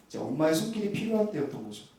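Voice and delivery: ordinary delivery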